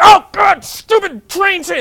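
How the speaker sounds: loud, high mock tantrum; furious outburst. A traveller on the wrong train, shouting loud and high in mock fury. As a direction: yell at the train in a hammed-up rage. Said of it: "The first time is you get super pissed".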